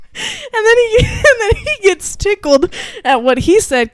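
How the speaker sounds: high, loud, through real laughter; helpless giggles breaking into the words; laughing. Real, not acted. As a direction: tell it while cracking up. Cracking up while retelling the joke.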